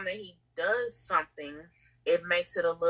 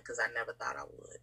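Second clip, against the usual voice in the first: breathy whisper, much softer, moved; 6 semitones lower, 10 dB quieter than usual. Real, not acted. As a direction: let it fall to a whisper, overcome. Emotional confession about being loved.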